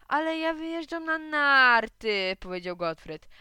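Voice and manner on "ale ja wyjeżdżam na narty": high, drawn-out wail; loud, slow plaintive protest; whining protest. Acted. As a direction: wail it like a punished child. Punished boy protests to supervisor.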